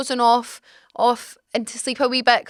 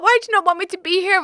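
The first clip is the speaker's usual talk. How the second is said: flustered protest; 7 semitones higher, faster than usual. Retold or imitated. Panicked protest to doctors.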